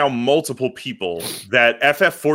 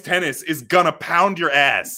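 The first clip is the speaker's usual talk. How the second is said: high emphatic shout; 7 semitones higher, slower than usual. Real, not acted.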